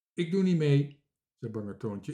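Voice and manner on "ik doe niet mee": loud, higher-voiced refusal; stubborn refusal. A narrator's reading. Bange Toontje refuses the circus plan, stubbornly. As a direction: refuse loudly like a balking child. Timid character refuses to join.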